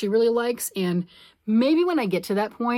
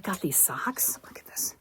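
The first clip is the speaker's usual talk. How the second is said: whisper; 8 dB quieter than usual. Real, not acted. Whispering about new socks.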